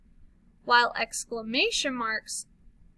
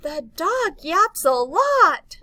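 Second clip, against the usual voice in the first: higher, louder and slow, full of excitement; excited reading; 5 semitones higher, 8 dB louder, slower than usual. Shown as a demonstration. Teacher reading an exclamation to kids, excited. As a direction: make the dog sentence thrilling. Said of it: "See how I'm, like, excited about it?".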